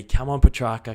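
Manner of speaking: loud shout of encouragement at the screen; yelling at the TV. Retold or imitated. Fan on the couch yelling at the TV, loud shout. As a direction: roar your player on from the couch. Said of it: "And I started yelling at the T V".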